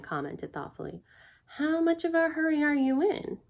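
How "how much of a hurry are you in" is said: Morwen's voice. A narrator's reading. Morwen asks Kazul, voiced high.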